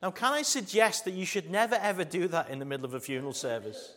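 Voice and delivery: loud, high, driving sermon voice; preaching at full force. Acted. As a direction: preach it out to the congregation.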